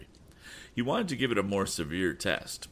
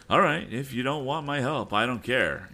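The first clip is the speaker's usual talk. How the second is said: higher, louder offhand reply; shrugging it off; 8 semitones higher, 5 dB louder than usual. A narrator's reading. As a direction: brush off the refusal. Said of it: "answered Tom".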